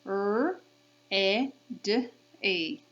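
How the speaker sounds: R, E, D, E as separate phonemes; sounded out. Shown as a demonstration. Teacher counting sounds with kids, sounded out. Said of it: "Let's count the sounds".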